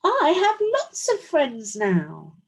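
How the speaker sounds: gleefully loud announcement; very loudly. Acted. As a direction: shout it out with joy. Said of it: "he said it again very loudly".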